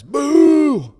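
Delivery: hammed-up crowd heckle; heckler jeers. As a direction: boo him off the stage like a heckler. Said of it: "that nagging goddamn fear of judgment".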